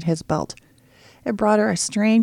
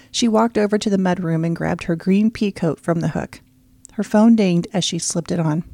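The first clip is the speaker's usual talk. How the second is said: plain reading voice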